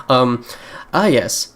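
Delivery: ordinary talk, nothing marked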